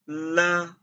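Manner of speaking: "l" as "luh" for repeating; model syllable. Shown as a demonstration. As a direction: pronounce "l" like "luh" for students. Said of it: "L pronounced as l".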